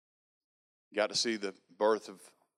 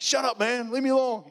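high, loud embarrassed snap; annoyed shout; 14 semitones higher, 6 dB louder than usual. Retold or imitated. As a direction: tell him to shut up, up high and loud. Snapping back at laughing roommate.

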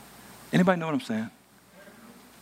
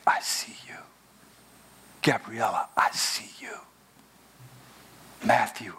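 whispered preaching; 4 semitones lower than usual. Acted. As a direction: whisper each name tenderly.

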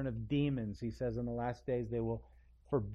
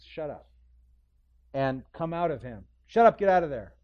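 blunt shut up; 2 semitones higher, 9 dB louder than usual. Shown as a demonstration. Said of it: "but really it's".

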